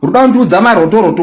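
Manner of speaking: usual speaking voice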